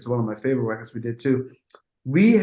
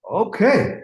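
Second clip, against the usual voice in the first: high mock okay; 8 semitones higher, 4 dB louder than usual. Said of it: "I'm like".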